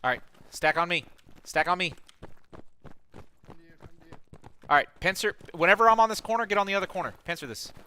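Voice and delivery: high loud tactical callouts; combat callouts. Real, not acted. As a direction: bark orders to the squad.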